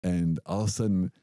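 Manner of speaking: ordinary delivery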